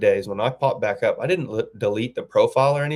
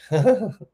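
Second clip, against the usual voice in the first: short, higher-pitched awkward laugh; 10 semitones higher, 3 dB louder than usual. Retold or imitated. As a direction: let out a brief acted laugh before answering. Acts out his awkward reply.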